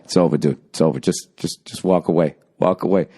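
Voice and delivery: low, unmoved imitation of a friend; low-voiced, stonewalling dismissal; deadpan buddy. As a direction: repeat it deadpan, pitched well down. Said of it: "He's just staring straight ahead".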